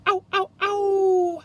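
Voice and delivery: very high, loud, slow howling ows; wolf howling after his nose is slammed; pained howl. A narrator's reading. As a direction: howl in pain like a hurt wolf. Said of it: "howled the wolf".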